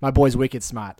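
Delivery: put-on Good Will Hunting style Boston accent. Guest quoting a movie line, imitated Boston accent. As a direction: drop the r like a Boston local. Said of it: "Matt Damon in, in, in Good Will Hunting".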